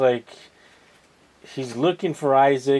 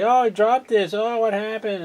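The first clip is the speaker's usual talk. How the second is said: high-pitched mock child; imitating little kid; 10 semitones higher than usual. Little kid who dropped something, high mock-childish voice. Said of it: "the little kid".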